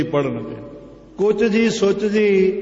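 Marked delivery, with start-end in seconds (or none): 1.2-2.5 ਕੁਚ ਜੀ ਸੁਚ ਜੀ split in pieces, pitched higher; split mispronunciation. Shown as a demonstration. Preacher citing the nonexistent word, ਕੁਚ ਜੀ ਸੁਚ ਜੀ split apart. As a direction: break ਕੁਚ ਜੀ ਸੁਚ ਜੀ before each ਜੀ, higher. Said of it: "ਕੋਈ ਸ਼ਬਦ ਨਹੀਂ ਹੈਗਾ".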